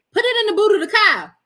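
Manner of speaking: lightly hammed-up dainty English; pinky-up English. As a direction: rattle it off with your pinky up. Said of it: "pinky up when you say it".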